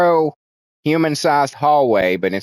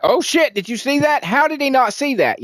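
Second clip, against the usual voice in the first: much higher, startled and urgent; shocked viewer. Retold or imitated. Viewer spotting something the cameraman missed, high shocked. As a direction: gasp it out in disbelief. Viewer reacting to hidden detail.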